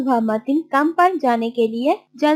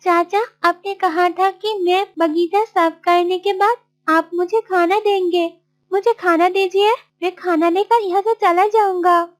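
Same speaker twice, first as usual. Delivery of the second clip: higher-pitched, earnest pleading; pleading Martin; 6 semitones higher than usual. Acted. Martin asking uncle for the promised food, pleading.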